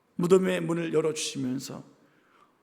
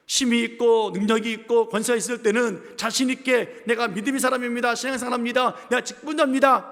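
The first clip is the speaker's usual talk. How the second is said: loud, high, driving sermon voice; fiery preaching; 4 semitones higher, 6 dB louder than usual. Acted. Pastor rebuking the faithless, loud fiery preaching. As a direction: pastor pressing the congregation, loud and rising.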